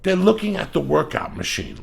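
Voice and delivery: ordinary talk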